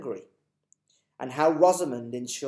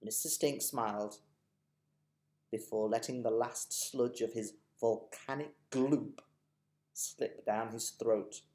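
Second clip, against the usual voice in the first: ordinary delivery, nothing marked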